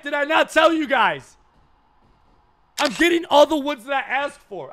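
normal talking voice

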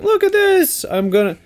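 high-pitched cartoon boasting; mock eager hero. Mimicking Ash entering a new area, high eager boast. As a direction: proclaim it with put-on boyish eagerness. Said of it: "created all this stuff and be like".

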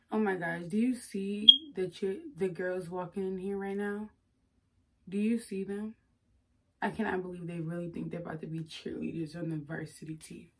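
low, hushed catty gossip; quiet disdain for the girls walking in; mean cheerleader captain. Acted. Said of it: "let me get mean".

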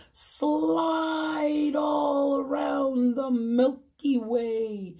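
playful whoop around the Milky Way; playful high call. Acted. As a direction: sing out the slide across the sky. Playful bedtime flourish.

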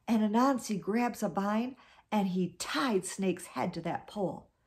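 ordinary delivery

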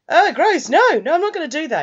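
high, loud mock disgust; disgusted refusal. Boy refusing the pervert's order to strip, hammed-up disgust. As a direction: act out a grossed-out, squealing protest.

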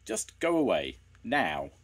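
loud command, high then dropping; sharp order. A narrator's reading. Man ordering two children away, commanding. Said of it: "the man ordered".

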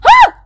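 sudden high loud shriek; startled shriek. Real, not acted. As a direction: yelp at the hit. Hit in game.

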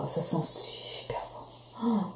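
slow breathy whisper while smelling. Real, not acted. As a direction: whisper it, savouring the scent. Sniffing the body mist. (chuchoté lentement)

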